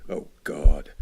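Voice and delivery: plain reading voice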